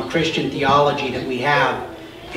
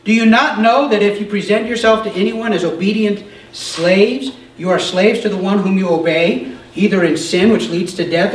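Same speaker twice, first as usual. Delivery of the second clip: raised, fervent reading voice; scripture reading; 5 semitones higher than usual. Acted. Pastor reading Romans aloud to the group, fervent. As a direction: proclaim it to the congregation.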